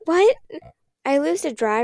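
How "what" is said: high, loud startled cry. A narrator's reading. Little sister startled by the thrown voices. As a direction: jump at the strange voice.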